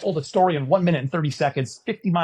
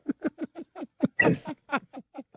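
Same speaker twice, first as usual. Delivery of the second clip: high-pitched burst of laughter; 13 semitones higher, 4 dB quieter than usual. Retold or imitated. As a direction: cut loose with a sudden high laugh. Host's reaction.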